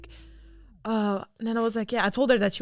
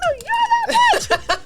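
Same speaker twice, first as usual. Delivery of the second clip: very high, slow, laughing no; laughing insistence; 10 dB louder, slower than usual. Retold or imitated. Fan insisting back to her idol, laughing high. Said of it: "I was like".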